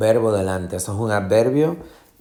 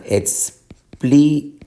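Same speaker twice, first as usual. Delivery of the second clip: explícamelo broken slowly into ex-PLÍ-ca-me-lo, raised after the start; syllable-by-syllable stress demo; 5 semitones higher, slower than usual. Shown as a demonstration. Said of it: "vas a tener la fuerza de pronunciación". (explícamelo sílaba por sílaba, despacio)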